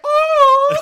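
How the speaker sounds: high, loud heavenly "Oh"; angelic voice. Retold or imitated. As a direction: sing out like an angel choir. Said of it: "is that supposed to be your angelic voice".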